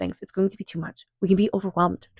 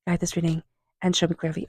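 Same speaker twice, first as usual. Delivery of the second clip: a hushed prayer to the spirit guides, softer than her chat; quiet invocation; 5 dB quieter than usual. Real, not acted. Reader calling on her guides before the cards, hushed prayer. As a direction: softly call on the guides.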